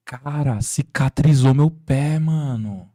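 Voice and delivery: slow, loud, stretched-out cry of disbelief; amazed exclamation. Retold or imitated. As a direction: drag out the words in stunned joy. Man amazed his foot healed.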